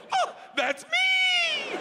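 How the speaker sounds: high, loud, drawn-out squeal; over-the-top elated shout; excited winner's shout. Retold or imitated. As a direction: shriek it out, stretching the last word. Acts out winning raffle.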